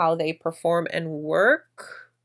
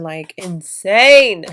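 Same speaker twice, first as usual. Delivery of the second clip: drawn out slowly and much louder on insane; emphatic insane; 10 dB louder, much slower than usual. Real, not acted. Vlogger to viewers, stressing how bad her allergies are. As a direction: lean hard into the word insane.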